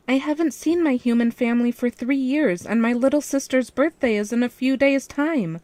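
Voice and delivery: excited squeal about the party; squealing excitedly. A narrator's reading. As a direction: gush it out, barely containing joy. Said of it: "I squealed excitedly".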